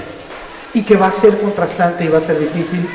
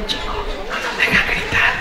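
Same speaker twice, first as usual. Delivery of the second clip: susurro cómplice; 7 dB quieter than usual. Retold or imitated. Maestra susurra a los alumnos.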